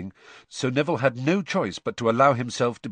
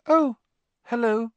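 unnaturally high voice; 4 dB louder than usual. Acted. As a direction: voice jumps way up, strained and false. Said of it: "his voice much higher than usual".

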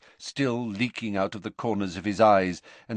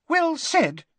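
very high squeaky little professor; squeaked. Acted. Flitwick applauding Sprout's words, high squeak. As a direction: squeak your approval. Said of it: "squeaked Professor Flitwick".

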